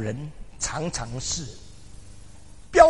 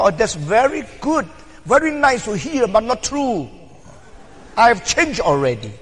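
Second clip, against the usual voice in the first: bright, loud, higher English reply, hammed up; mock honest reply; 7 semitones higher, 7 dB louder than usual. Preacher voicing a frank reply to an old friend, loud and high. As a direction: play the blunt friend answering in English.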